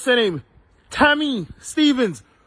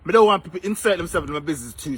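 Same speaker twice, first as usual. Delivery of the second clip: ordinary talk, nothing marked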